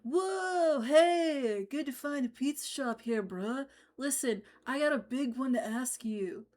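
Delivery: laid-back surfer bro; surfer dude voice. Acted. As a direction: drawl it like a chill surfer. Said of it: "Where did that voice come from?".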